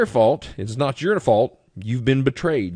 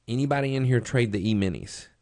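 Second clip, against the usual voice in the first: ordinary talk, nothing marked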